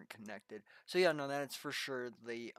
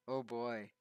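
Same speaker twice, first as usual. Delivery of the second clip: ordinary talk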